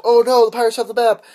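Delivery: loud, high cry of dismay; hammed-up panic; mock alarm. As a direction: announce it as a pretend disaster. Acts out alarm at losing map.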